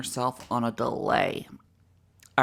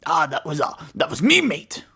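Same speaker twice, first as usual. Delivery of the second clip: mock Cockney; 5 dB louder than usual. Player parroting the Cockney robot's line, loud mock Cockney. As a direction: throw out a hammed-up Cockney echo.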